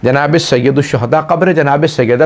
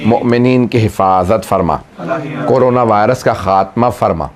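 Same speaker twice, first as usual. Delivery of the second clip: closing prayer; 6 semitones lower than usual. Acted. Closing supplication.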